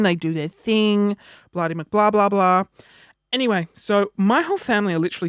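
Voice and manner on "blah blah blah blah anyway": ordinary delivery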